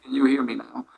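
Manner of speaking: faint, distant mic audio; barely audible. Real, not acted. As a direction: voice coming through weakly. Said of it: "your voice is very low".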